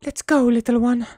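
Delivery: high, sing-song call to a little animal; cutesy pet voice. Acted. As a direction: coax the pet along in a high baby voice. Calling cheerfully to a pet.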